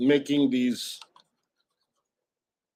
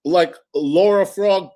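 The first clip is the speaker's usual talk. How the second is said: mock chatter request; 7 semitones higher, 6 dB louder than usual. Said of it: "someone says".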